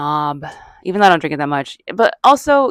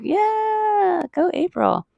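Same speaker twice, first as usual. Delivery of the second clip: squeaky, much higher cheer to herself; a jokey pep-talk to herself, pitched way up; mock self-cheer; 4 dB quieter, much slower than usual. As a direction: cheer yourself on in a tiny high voice. Cheering herself on after risky moment.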